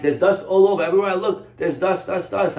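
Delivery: hammed-up frustrated homeowner insisting on dust; exasperated complaint. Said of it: "The lady's like".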